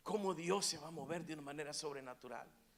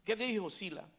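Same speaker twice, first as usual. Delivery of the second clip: ordinary talk, nothing marked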